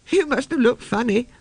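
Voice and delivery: put-on high, giggly girl voice; little girl gurgling with laughter. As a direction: gurgle it out with amusement. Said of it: "gurgled Nancy".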